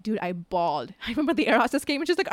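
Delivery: ordinary talk, nothing marked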